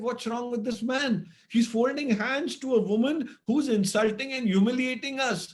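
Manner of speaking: put-on indignant child. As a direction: protest in disbelief at your father.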